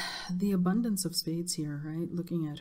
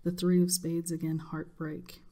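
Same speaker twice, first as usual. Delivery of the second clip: ordinary delivery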